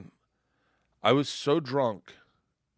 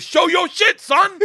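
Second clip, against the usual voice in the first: loud, high dare; full-volume stage-crasher's challenge; shouted challenge; 11 dB louder, slower than usual. Retold or imitated. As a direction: holler it as a dare. Ray challenges him, shouting.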